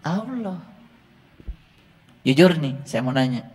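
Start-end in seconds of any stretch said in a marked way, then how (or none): 0.1-0.6 soft, offhand "Tuhanku Allah"; mock offhand claim. Preacher mimics people who claim God casually. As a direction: toss off the claim quietly and casually. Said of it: "Ujuk-ujuk mengatakan".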